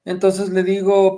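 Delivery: ordinary talk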